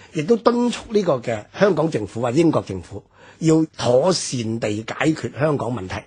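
ordinary talk, nothing marked

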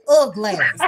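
Chicago style ugly ass, oglass; ugly ass the Chicago way, slurred into oglass. Shown as a demonstration. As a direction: say ugly ass as one run-together word, oglass. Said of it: "that's how we say it from Chicago".

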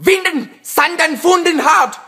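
mock German yelling; fake German. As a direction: fake German gibberish rant. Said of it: "yell at your mom in fake German".

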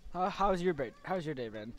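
ordinary delivery

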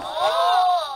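sharp excited gasp; a little piglet gasp of wonder. Acted. Peppa gasps at the big rocks. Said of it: "gasped Peppa".

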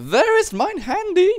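put-on very high, loud comic German accent; terrible German accent. Gamer joking about his phone for viewers, terrible German accent. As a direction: shriek it slowly in a hammed-up German accent. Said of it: "It's a terrible German accent".

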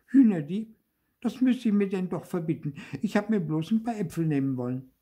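high, quick puppet protest; indignant denial. A narrator's reading. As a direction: protest your innocence fast and high. Puppet rejects accusation of theft. (hoch, schnell und entrüstet)

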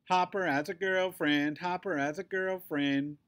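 high, loud playground chant; sing-song taunt. A narrator's reading. Bullies teasing Hopper about a girlfriend, taunting sing-song. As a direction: tease him in a repeated sing-song.

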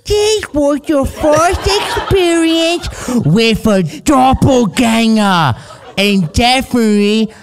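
high, slow mock British baby voice; baby Brit accent. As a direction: ham up a drawn-out, squeaky British accent. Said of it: "Yours is like a baby Brit".

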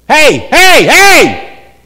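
loud, high repeated shouts; insistent calling. Acted. Parent trying to get a kid's attention, insistent calling.

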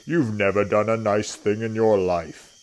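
loud, accusing elephant; Trunky. A narrator's reading. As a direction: scold loudly in a big elephant voice. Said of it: "said Trunky".

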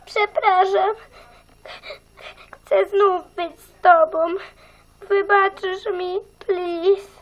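high, tearful begging apology; tearful plea. Acted. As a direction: plead to win him back, near tears. Girl begs boyfriend's forgiveness.

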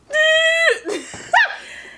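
high shrieking burst of laughter. Real, not acted. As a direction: shriek with laughter when the password fails. Password fails, she bursts out.

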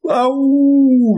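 very high, loud yelp of pain; scream of pain. A narrator's reading. The wolf lands in boiling water, shrieks in pain. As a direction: shriek as if scalded. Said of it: "wrzasnął z bólu".

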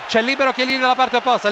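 ordinary talk, nothing marked

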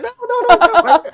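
very high panicked cries breaking into laughter; frantic laughing yell. Real, not acted. Desespero e risada na jogada. (grito desesperado rindo)

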